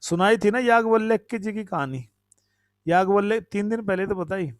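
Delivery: ordinary talk, nothing marked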